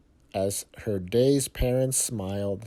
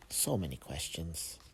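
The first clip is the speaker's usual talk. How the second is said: fading murmur; 6 semitones lower, 14 dB quieter than usual. A narrator's reading. Narrator sighs at questions.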